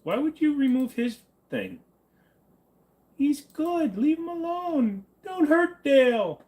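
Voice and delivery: high, whiny mock protest; whiny pleading. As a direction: plead like a hurt child, slowly. Pleading for a removed chatter.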